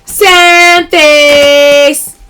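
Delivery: playful loud callout; loud catchphrase call. Real, not acted. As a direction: announce it to the chat. Live sale callout.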